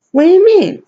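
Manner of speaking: high-pitched, put-on protest; mock innocent protest. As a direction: act out his defensive comeback. Mimics Miguel confronted by her.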